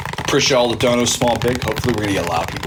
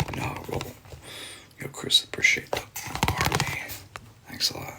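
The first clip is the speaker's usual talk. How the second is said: ASMR whisper. Acted. ASMR streamer thanking donors in a whisper. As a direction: whisper the thanks close and intimate.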